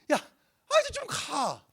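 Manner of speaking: high, pleading push, fed up but fond; like nudging a stubborn friend out the door; exasperated urging. Shown as a demonstration. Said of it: "그냥 이런 뉘앙스였거든".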